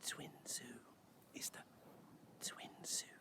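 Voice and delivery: hushed, voiceless whisper of the name; barely audible, secretive whisper. Shown as a demonstration. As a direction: whisper it as if it must stay quiet. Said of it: "why is [name] whispering".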